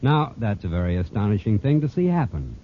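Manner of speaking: ordinary talk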